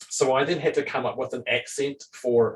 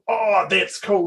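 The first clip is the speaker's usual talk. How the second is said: higher-pitched wowed reaction; impressed players; 8 semitones higher, 4 dB louder, slower than usual. Retold or imitated. Players admiring the game master's improvised idea, impressed. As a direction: let the "oh" jump up in admiration.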